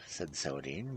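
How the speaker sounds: plain reading voice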